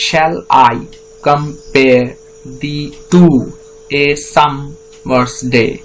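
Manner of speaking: "Shall I compare the two, a summer's day?" slowly in pairs, second syllable hit harder; iambic scansion. Shown as a demonstration. Teacher demonstrating metre to students, slow reading with alternating weak and strong syllables. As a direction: chop "Shall I compare the two, a summer's day?" into iambs, pausing between feet, stressing each second beat. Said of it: "এবার আমরা যদি পড়ি সেটাকে দেখা যাক".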